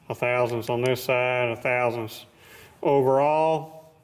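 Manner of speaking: ordinary delivery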